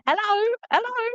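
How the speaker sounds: high-pitched repeated hello; put-on high sing-out; mum calling out. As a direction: imitate mum's high, searching call. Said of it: "would you understand that mum be going".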